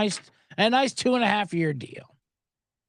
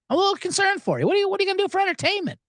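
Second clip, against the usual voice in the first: higher and much faster, hammed-up concern; exaggerated concerned rep; 12 semitones higher, much faster than usual. Comic repeating the rep even higher and faster. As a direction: overdo her syrupy worry. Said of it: "That's what she said".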